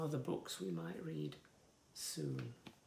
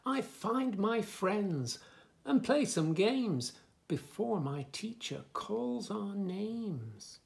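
lively, sing-song story reading; reading to children; 5 semitones higher, 7 dB louder, slower than usual. Acted. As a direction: bounce the rhymes for little listeners. Reads book text.

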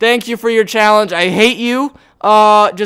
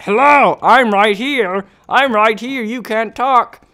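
mock yell, raised and drawn out; yelling in ear; 4 semitones higher, slower than usual. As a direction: imitate a pest yelling right into an ear. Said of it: "it's like a guy yelling in your ear".

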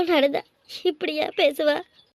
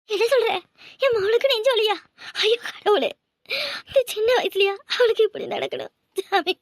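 a mother jolted by terrible news; panicked mother hearing her daughter is ill; shocked cry; 5 semitones higher than usual. Acted. Mother hears daughter's heart attack.

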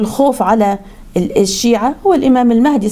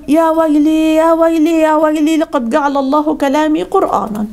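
wailing Iblis; 6 semitones higher than usual. Acted. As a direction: lament your doom in one long high cry. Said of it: "رجع إبليس صرخ صرخة كبيرة قوي". (عويل إبليس متحسرًا)

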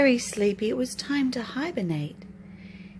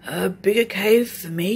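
yawning; 3 semitones lower, 3 dB louder than usual. Acted. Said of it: "yawned Bear".

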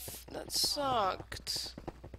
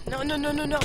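loud, higher protest; panicked no; 5 semitones higher, 8 dB louder, much faster than usual. Real, not acted. Gamer getting killed, crying out in dismay. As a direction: cry out in dismay. Dying in game.

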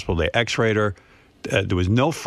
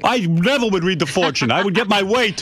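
father scolding mother over the fortune, higher mock voice; imitating her father; 9 semitones higher than usual.

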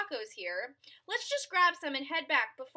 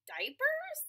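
puzzled high question; 13 semitones higher than usual. Acted.

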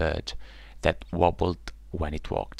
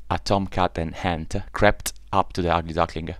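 usual speaking voice

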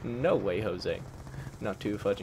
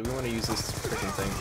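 ordinary talk, nothing marked

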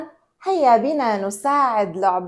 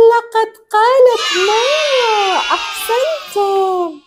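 excited cheering; 10 semitones higher than usual. Acted. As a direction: celebrate like a proud parent. Cheering the toy's first word.

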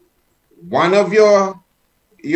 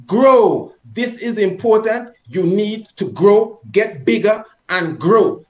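higher, insistent order; stern, energetic command; 5 semitones higher, 4 dB louder than usual. Shown as a demonstration. Teacher urging his hand to get bigger, stern and forceful. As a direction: drive it home as to a child. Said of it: "as if you're disciplining your child".

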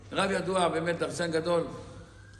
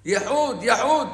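retold yell; 4 semitones higher, 6 dB louder than usual. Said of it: "הוא צועק לייחוד".

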